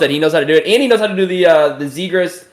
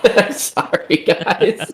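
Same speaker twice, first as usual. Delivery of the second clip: laughing; 6 semitones higher, much slower than usual. Real, not acted. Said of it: "I'm just laughing".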